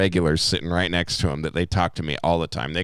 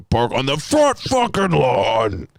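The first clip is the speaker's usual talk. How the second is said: shouted rant about parking, a bit higher and louder; indignant shout; 6 semitones higher, 4 dB louder, slower than usual. Real, not acted. Ranting to his friend about college parking tickets, shouting. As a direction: yell it like you're fed up.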